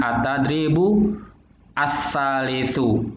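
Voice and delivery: "At-tadribu as-salisu" read slowly, every vowel full; slow careful Arabic reading; slow model reading. Shown as a demonstration. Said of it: "bacanya gimana?".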